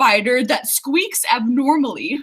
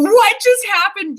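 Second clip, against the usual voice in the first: higher, louder imitated startled question; mock shock; 7 semitones higher, 5 dB louder than usual. Voicing viewers who saw her freak out, mock shock. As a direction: imitate someone alarmed and baffled. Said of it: "laughing at me and going".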